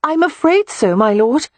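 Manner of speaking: sorry but light about it; apologetic young lady. Acted. Beatrix apologizing to the earl, high and apologetic. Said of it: "Beatrix said apologetically".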